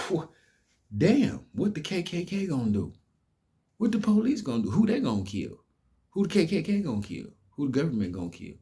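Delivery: high, loud mocking disbelief; mock incredulous street voice. Mocking Chicago gang members' baffled disbelief, high and loud. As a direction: ham up the baffled shout.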